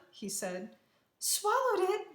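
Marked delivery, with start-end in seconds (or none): 1.2-2.1 very high, alarmed question; worried cry. A narrator's reading. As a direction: cry out in disbelief and worry. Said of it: "Maria cried, her eyes big with worry".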